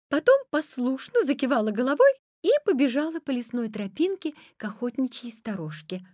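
usual speaking voice

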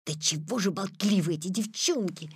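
ordinary delivery

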